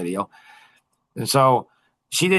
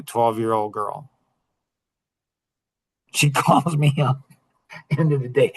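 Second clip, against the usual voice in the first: ordinary talk, nothing marked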